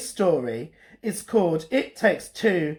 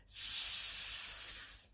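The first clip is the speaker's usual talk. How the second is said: long hissing sss; snake hiss; 20 dB quieter than usual. Acted. Snake answering Olive, hissing. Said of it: "a snake saying".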